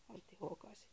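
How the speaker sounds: usual speaking voice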